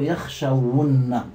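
يخشون slowly as yakhshawunna, voweled w; damma on waw. Shown as a demonstration. Teacher shows the waw given damma, slow low يخشون. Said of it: "نحرك الواو بالضم".

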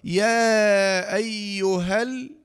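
long "يا", short "ها" straight into l of "ال"; long madd, dropped vowel. Shown as a demonstration. Teacher showing the dropped vowel, slow "يا أيها ال" joined. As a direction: hold "يا", clip "أيها ال" into the lam. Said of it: "إلى اللام الساكنة مباشرة".

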